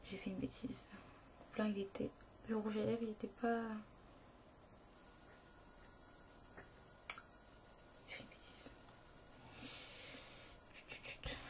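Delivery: hushed whisper after a mistake; soft unvoiced whisper. Real, not acted.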